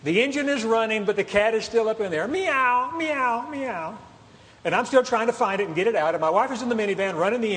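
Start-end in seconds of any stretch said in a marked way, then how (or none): 2.3-4.0 higher mewing cat imitation, fading at the end; cat meowing. Cat hidden in the running engine, mild high mewing.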